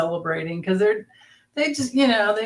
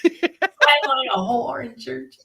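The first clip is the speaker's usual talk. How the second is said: slow Southern drawl, higher and laughing; Southern accent; 4 semitones higher, slower than usual. Shown as a demonstration. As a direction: stretch it out in a playful Southern accent. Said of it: "in a Southern accent".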